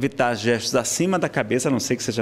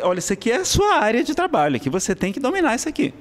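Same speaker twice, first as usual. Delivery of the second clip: much higher, coaching yourself on arrival; pep talk to yourself; 11 semitones higher than usual. Acted. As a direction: voice a firm inner coach, pitched up. Telling yourself at the venue.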